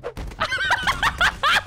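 sudden shrill giggle burst; high burst of laughter. Real, not acted.

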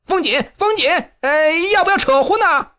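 high panicked call. A narrator's reading. Gai Yiming calling retreat to his brothers, high alarmed shout. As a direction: shout it high, ready to flee. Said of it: "盖一鸣大叫".

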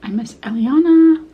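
noodles at lips; talking around food; speaking while eating noodles. Real, not acted. Seen 3: Cucumber is gone; chopsticks lift noodles to her open mouth.

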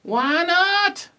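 high, rising cry from the Roos; high-pitched cheering question; loud cheer. A narrator's reading. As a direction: call it out as a cheering crowd. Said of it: "cried the Roos with their super loud cheer".

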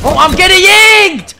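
high, loud alarmed yell; panicked shout. Real, not acted. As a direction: cry out in alarm. Under fire, panicking.

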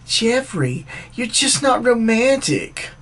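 high, drawn-out girlfriend impression; mocking imitation of a disappointed girlfriend, slow and high. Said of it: "She says to me".

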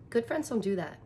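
usual speaking voice

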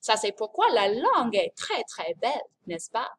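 "Ça, c'est pourquoi" in French with very mobile lips; mobile French mouth. Shown as a demonstration. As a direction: swoop through "très, très belle" with exaggerated French articulation. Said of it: "the French are always moving".

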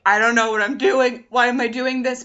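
loud, panicked self-questioning; retold panic. Telling of her panic at stoplights, loudly panicked. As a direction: exclaim it in a mock panic.

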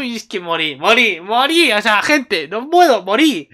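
high, loud swoops on each phrase; dramatic, delighted despair; overwhelmed fan gushing. Real, not acted. Overwhelmed fan reacting to the scene.

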